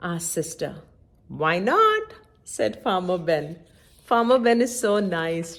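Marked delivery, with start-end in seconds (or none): 1.4-2.1 high, loud and cheery; jolly permission. A narrator's reading. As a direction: answer with a grin. Farmer Ben answers again.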